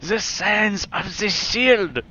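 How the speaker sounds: hammed-up German accent, high, loud and slow; Sturmgard. Gamer echoing a favorite shield warrior, mock German accent. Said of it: "I love Sturmgard".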